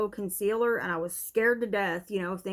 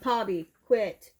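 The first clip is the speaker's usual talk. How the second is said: louder snap at the dog; sharp call to dog; 4 dB louder, slower than usual. Real, not acted. Scolding the dog mid-video.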